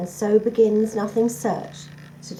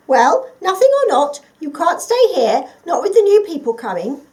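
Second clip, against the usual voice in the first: high, loud squeaky little mouse voice; 8 semitones higher, 11 dB louder than usual. Acted. Mouse warning the forgotten creature, high and squeaky. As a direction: pipe it up like a busy little mouse. Mouse tells Nothing to leave.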